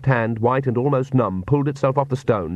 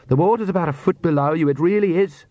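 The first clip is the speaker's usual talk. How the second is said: higher, quick and coaxing; lightly voiced confident Perdita; 7 semitones higher, faster than usual. A narrator's reading. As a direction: urge her on with mild impatience. Voices Perdita urging Agnes.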